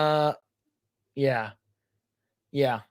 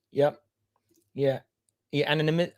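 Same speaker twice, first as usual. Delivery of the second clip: usual speaking voice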